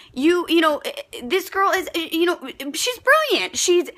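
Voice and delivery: ordinary delivery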